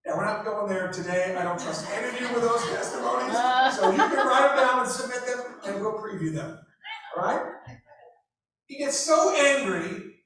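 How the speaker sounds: ordinary delivery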